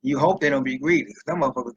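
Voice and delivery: ordinary delivery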